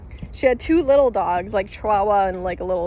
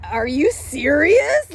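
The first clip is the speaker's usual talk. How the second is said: slow, drawn-out, higher incredulous question; mock disbelief, stretched out; retold disbelief; 5 semitones higher, 3 dB louder, much slower than usual. Speaker reacting to police threat, slow incredulous mock.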